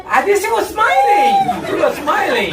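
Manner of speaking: mock delight. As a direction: squeak it out in delight. Grandfather's blissful smile.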